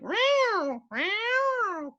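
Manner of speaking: very high falling meows; meowing back. Retold or imitated. The owner mewing back at a cat, very high.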